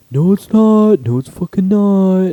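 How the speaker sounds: high, loud mock protest; retold loud denial. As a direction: imitate a classmate loudly refusing to accept it. Retold argument, classmate denies it.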